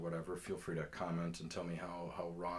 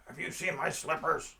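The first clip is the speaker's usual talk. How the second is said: raised, higher character voice; pestering panhandler; 10 semitones higher, 9 dB louder than usual. Retold or imitated. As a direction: call out the question as a street oddball. Acting out slipper-wearing panhandler accosting him.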